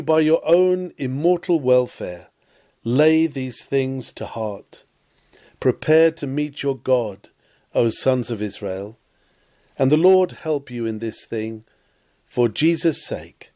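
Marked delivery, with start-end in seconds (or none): none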